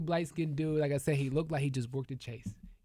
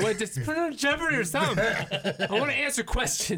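laughing mock plea; 7 semitones higher than usual. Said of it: "I'll be like".

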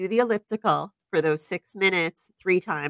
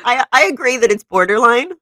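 amused concession; 5 semitones higher, 7 dB louder than usual. Real, not acted. Laughing concession, louder first take.

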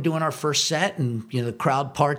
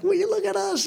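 amazed delight. Retold or imitated. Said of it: "he's just like".

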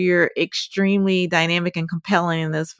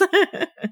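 laugh; 13 semitones higher than usual. Real, not acted. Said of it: "Oh, love that".

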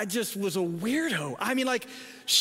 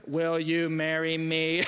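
retold low, slow, stiff proposal; scared proposal; 6 semitones lower than usual. Groom on one knee proposing to girlfriend, scared. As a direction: drop low and slow, acting out frozen nerves. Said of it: "I was so scared".

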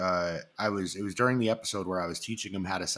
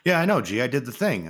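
higher, louder clueless buddy voice, eager to please; lightly voiced goofy friend; 4 semitones higher, 6 dB louder than usual. Fish the co-host insisting to the host he did it, lightly voiced goofy. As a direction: play the dim sidekick who thinks he got it right.

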